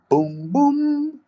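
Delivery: high rising announcer boom; announcement boom. Shown as a demonstration. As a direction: ring out boom like a fanfare. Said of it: "like an announcement".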